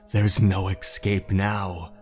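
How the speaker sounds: plain reading voice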